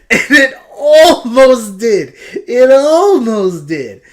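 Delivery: half-laughing fake sob over a failed deck; mock sobbing. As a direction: wail it like fake tragedy.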